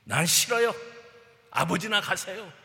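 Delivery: loud, defiant outburst; stubborn refusal. Acted. Elder son refusing his father, loud and defiant. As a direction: shout it back like a sulking son. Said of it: "고집부리며".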